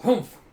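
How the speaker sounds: loud, high contemptuous grunt; scornful humph. Retold or imitated. Toji scoffing at the kids, loud humph. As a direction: scoff hard before striking.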